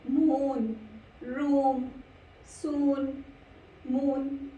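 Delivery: मून, रूम, सून with the OO held; long OO sound. Shown as a demonstration. Teacher models double O words, long OO in मून, रूम, सून. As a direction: stretch the double-O vowel in each word, मून to सून. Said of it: "ऊ का sound आ रहा है".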